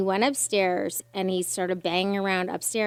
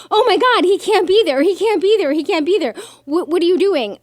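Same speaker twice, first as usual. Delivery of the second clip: much higher and louder alarmed repeats; hammed-up panic, much higher, loud; panicked dispatcher; 10 semitones higher, 11 dB louder than usual. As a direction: imitate the dispatcher freaking out.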